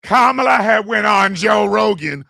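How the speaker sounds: loud mock outrage, voice shooting up; mocking shout. Mocking online commenters with a loud outraged yell. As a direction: yell it like an incredulous fan. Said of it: "People were literally going".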